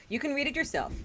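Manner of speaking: ordinary talk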